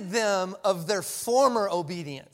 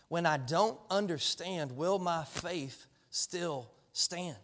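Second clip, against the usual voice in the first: much slower, drawing out every word, quiet; slow emphatic repeat; 3 semitones lower, 8 dB quieter, slower than usual. Real, not acted. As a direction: repeat it slowly so it lands.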